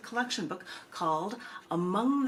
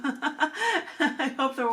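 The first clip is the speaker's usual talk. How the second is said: laughing; 7 semitones higher, 5 dB louder, much slower than usual. Real, not acted. Laughing after the kiss.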